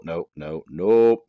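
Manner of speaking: ordinary delivery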